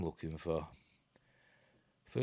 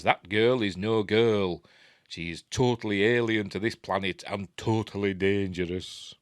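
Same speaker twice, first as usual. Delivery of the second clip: put-on Yorkshire accent; 4 dB louder than usual. Retelling the scientist's warning about the girl, in a Yorkshire accent. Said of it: "But not in a Yorkshire accent".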